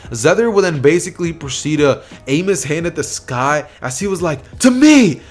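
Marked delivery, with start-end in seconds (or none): 4.6-5.2 high, loud battle cry; heroic call. Acted. Zether raising his hand to the sky, shouting. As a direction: summon the sky with a commanding shout. Hero calls down lightning.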